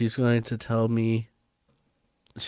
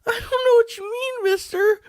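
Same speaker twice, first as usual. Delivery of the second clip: very high, crying plea; tearful cat lady plea. Acted. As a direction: beg for help in a squeak. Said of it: "I am a crazy cat lady".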